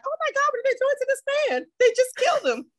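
high, fast mock shriek of horror; mock horrified. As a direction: squeal it like a scandalized viewer.

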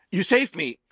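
loud, high cry on waking; startled awake. A narrator's reading. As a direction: blurt it out as if jolted from a dream. Jolted awake from a dream.

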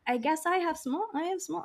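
high, softened little voice; mock meek aside. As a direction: replay it in a small high voice. Replays her own self-deprecating remark.

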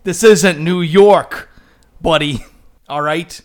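loud gruff Boston bartender voice; hammy Boston tough guy, scolding; Boston accent. Retold or imitated. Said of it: "Boston accent".

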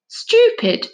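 outraged that anyone could say that; hotly. A narrator's reading. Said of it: "said Charlie hotly".

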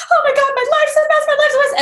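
high, fast, laughing mock panic; mock panicked friend. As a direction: squeal it like a friend in a meltdown, laughing. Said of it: "she's like".

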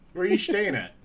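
casual Philly local asking; Philadelphia accent. Acted. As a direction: lean into the Philly vowels. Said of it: "stuck to a Philly accent".

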